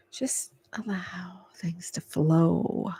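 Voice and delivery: very slow, low whisper; calming whisper. Real, not acted. Reader advising the client to chill and take a break, calming whisper. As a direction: let it drift out softly, drawn out.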